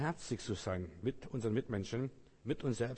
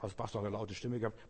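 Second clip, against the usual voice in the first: ordinary talk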